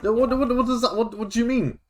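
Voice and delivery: usual speaking voice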